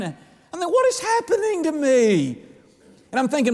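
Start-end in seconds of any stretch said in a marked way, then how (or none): 0.0-2.4 much higher, pleading wonder; retold bewilderment. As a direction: ask it overcome with surprise. Retelling crying at salvation.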